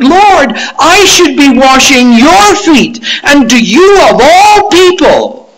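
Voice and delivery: high, loud, scandalized protest; aghast Peter protesting. Acted. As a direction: cry out in disbelief, leaning on the pronouns. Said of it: "Peter is saying in effect that".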